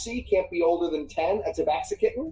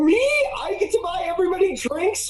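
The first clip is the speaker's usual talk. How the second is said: much higher, startled and a bit dismayed; surprised gee; 14 semitones higher than usual. Acted. Human suddenly told to buy everyone drinks, startled. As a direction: caught off guard, stuck with the bill.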